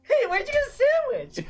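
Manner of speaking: gleeful high squeal. Retold or imitated. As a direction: squeal it like you just spotted a sandwich. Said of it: "with eyes of glee".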